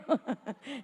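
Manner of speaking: brief chuckle. Real, not acted. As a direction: chuckle quietly at your own reasoning. Laughs at own reasoning. (risita breve y baja)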